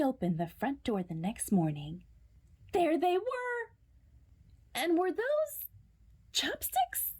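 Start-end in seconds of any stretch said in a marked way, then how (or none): 2.7-7.1 high, slow disbelief ending in a whisper; astonished. A narrator's reading. Reading to children, astonished as the underwear returns. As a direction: gasp at the surprise return. Shocked discovery.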